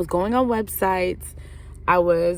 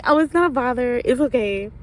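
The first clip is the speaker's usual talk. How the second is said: higher, louder fake reassurance; put-on politeness; 5 semitones higher, 4 dB louder than usual. Answering friend's boyfriend's apology while annoyed, falsely polite and high. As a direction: lift the voice into breezy false politeness.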